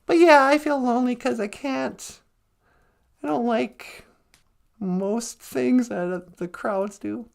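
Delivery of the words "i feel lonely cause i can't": ordinary delivery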